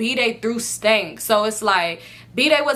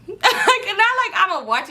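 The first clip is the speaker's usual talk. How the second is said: higher, laughing through the words; 10 semitones higher, slower than usual. Real, not acted. To her listeners, laughing at her own joke. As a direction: giggle it out.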